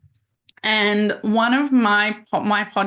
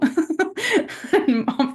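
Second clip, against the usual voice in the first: nervous laugh; 9 semitones higher than usual. Real, not acted. Nervous about guest.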